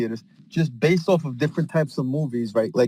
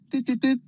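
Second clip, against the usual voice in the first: pitched way up beeping; high microwave beeps; 11 semitones higher than usual. Acted. Punching microwave buttons mid-scene, high beeping.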